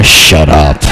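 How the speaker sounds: acted low growl back; grumpy retort. Bad-mood riser answering the sweet sister, grumpy low retort. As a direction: cut the cheer dead, pitch dropped right down.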